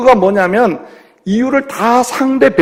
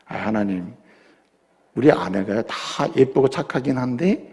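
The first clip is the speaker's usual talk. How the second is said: timid prayer; 11 semitones lower, 11 dB quieter than usual. Acted. Husband's timid prayer to God.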